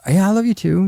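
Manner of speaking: high, automatic I love you too; dutiful reply. Retold or imitated. Husband replying to his wife out of habit, high and automatic. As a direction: answer on reflex. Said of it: "And I'm like".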